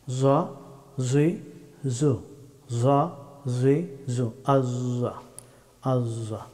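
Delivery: "জ, জি, জু" as heavy zwa, zwi, zu; full-mouthed Arabic za. Shown as a demonstration. Teacher demonstrating the letter za, heavy full-mouthed z. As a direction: fill the mouth, buzzing z in "আজ্জা". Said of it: "মুখ ভর্তি করে উচ্চারণ".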